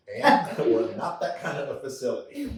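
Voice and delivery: amused, loud brush-off; laughing retort. Retold or imitated. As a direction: laugh it off as you turn her down. Telling off the gym request.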